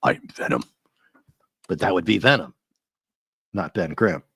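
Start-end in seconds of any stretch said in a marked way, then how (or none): 0.0-0.6 much lower raspy whisper. Shown as a demonstration. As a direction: whisper it low like a symbiote monster. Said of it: "I could do my Venom voice".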